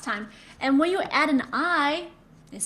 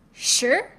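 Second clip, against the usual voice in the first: "Sure" gliding upward like a question; second tone; 4 dB louder than usual. Shown as a demonstration. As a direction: model "Sure" with a rising tone, R barely reached. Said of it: "Now let's give it a second tone".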